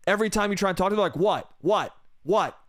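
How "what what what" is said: short, testy repeated replies; mock hangry boyfriend, curt and impatient; irritated snapping. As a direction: snap each one off separately.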